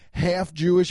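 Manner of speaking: ordinary talk, nothing marked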